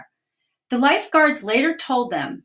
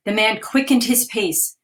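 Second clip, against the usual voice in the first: ordinary delivery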